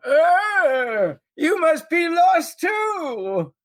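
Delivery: put-on high, drawn-out old codger voice; old man. Old man getting in the strangers' way, high creaky-slow call. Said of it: "old man's gonna get in the way".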